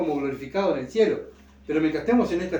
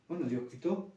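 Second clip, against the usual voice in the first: much softer, hushed resignation; quiet acceptance; 10 dB quieter than usual. Pretend. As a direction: say it under your breath, giving it up. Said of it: "este mismo pensamiento de Job". (aceptación en voz muy baja)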